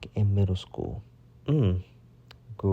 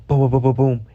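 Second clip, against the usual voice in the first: loud, rapid booms as knocks; higher, louder knocking sound effect; imitated door banging; 4 semitones higher, 8 dB louder, faster than usual. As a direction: bang out the knocks with your voice.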